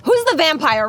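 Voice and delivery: ordinary delivery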